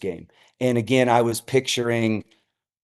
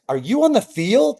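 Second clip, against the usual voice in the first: high, disbelieving question; surprised text; 9 semitones higher than usual. Retold or imitated. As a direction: read it like a shocked sister.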